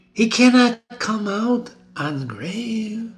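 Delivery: high, slow, haunted queen's voice; sleepwalking Lady Macbeth. Acted. Reader voicing Lady Macbeth walking in her sleep, high and haunted. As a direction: plead into the dark as if asleep.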